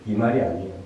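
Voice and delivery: normal talking voice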